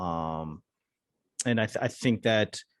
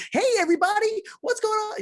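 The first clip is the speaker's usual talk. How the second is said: much higher, hyped-up host greeting; fake podcast host. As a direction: overdo the cheesy radio-host hello. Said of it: "Who fucking talks like that?".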